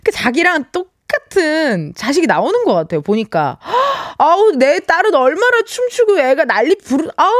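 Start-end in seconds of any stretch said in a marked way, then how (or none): 3.6-4.1 1 sharp gasp. Real, not acted. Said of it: "나 지금 상상했는데".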